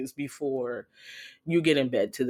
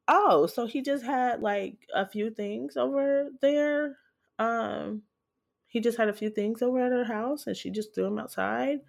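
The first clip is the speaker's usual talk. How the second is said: high, mock-innocent questioning; naive past self; 6 semitones higher than usual. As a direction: sound clueless on purpose, a touch hammed-up. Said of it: "sitting on the couch like".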